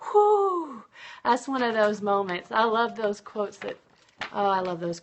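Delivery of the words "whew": a high, loud whew exhaled after the quote; impressed whew. Real, not acted. Reacting to a quote she loves, a big high whew. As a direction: let out a big whew, struck by it.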